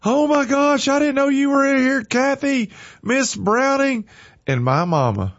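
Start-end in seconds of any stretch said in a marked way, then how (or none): 0.0-4.1 high, bubbly surprised greeting; gushing at the sight of an old friend; excited squeal. Retold or imitated. Teacher bursting into a room, squealing with surprise. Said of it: "She come running in".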